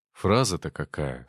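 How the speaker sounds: usual speaking voice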